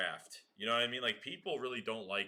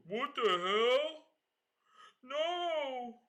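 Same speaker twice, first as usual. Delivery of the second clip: high, drawn-out mock disbelief; mock outraged friend; 3 dB louder, much slower than usual. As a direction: stretch it out, squeal the no like the friend will. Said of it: "He's gonna be like".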